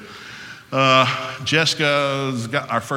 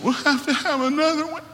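high, put-on voice of the wife; wife's voice. Wife in delivery room asking for another child, high mimicked voice. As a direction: imitate her in a much higher voice.

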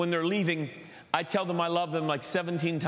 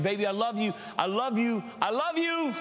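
gushing, climbing higher with each repeat; mock gushing affection; 6 semitones higher than usual. Father imagines smothering his daughter with love, mock gushing. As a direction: build from fond to high, over-the-top doting.

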